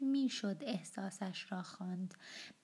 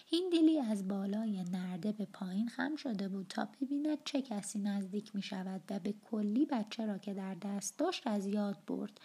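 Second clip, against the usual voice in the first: plain reading voice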